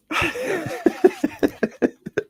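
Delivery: high, giddy nervous laugh. Real, not acted. Laughing at her own nerves.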